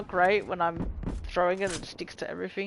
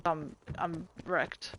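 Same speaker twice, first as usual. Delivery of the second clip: tired; 3 semitones lower, 8 dB quieter, much slower than usual. Real, not acted. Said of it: "I'm pretty fucking tired".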